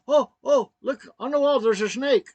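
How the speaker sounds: high, loud cries of alarm; imitating wife's panic. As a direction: act out her fright at full volume. Wife sees a snake on wall.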